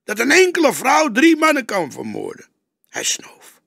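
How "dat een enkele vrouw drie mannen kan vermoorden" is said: much higher, loud indignation; young detective protesting, fierce. A narrator's reading. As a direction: keep the heat up. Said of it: "riep hij fel".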